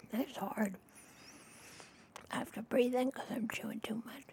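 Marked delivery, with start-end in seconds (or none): 2.3-4.2 ASMR whisper. Acted. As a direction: whisper it close to the mic. Whispers while chewing.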